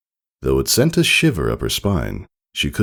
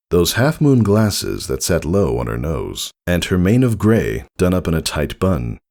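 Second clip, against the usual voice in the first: ordinary delivery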